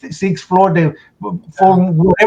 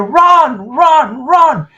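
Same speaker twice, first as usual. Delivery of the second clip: shouted warning; 9 semitones higher, much slower than usual. Retold or imitated. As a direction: yell at everyone to run. Someone shouting warning, building falling.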